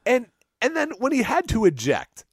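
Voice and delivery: ordinary talk, nothing marked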